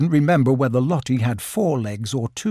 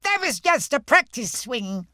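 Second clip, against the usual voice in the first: little Roo; 10 semitones higher, 3 dB quieter than usual. Acted. Roo pleading to join the match, high and eager. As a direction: squeak it out like a tiny eager joey. Roo excuses his fall.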